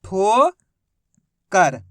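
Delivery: ठोकर stretched very slowly into one word; drawn out blend. Shown as a demonstration. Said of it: "तो मिलके बन गया अपने".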